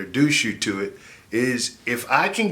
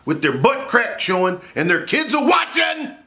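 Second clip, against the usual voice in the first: rising rant; 11 semitones higher than usual. Acted. Ranting about a Walmart meltdown.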